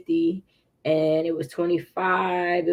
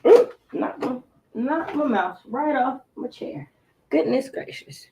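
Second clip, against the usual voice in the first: startled oops; 5 semitones higher than usual. Real, not acted. Knocked mouse off chair.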